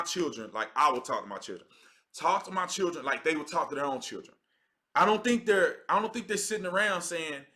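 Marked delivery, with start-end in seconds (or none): none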